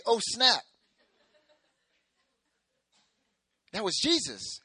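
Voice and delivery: loud, high, excited "oh snap"; hyped slang exclamation. Pretend. Disciples realizing it was Jesus, loud excited exclamation. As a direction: shout it like a stunned friend who just got it. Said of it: "this is the Marcus International version".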